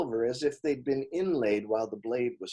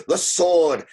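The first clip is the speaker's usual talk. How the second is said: put-on loud, firm demand with a plain accent; loud demand, accent dropped; 3 semitones higher, 8 dB louder than usual. A narrator's reading. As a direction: demand it loudly, no accent this time. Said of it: "Surt demanded".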